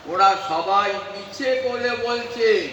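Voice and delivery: ordinary talk, nothing marked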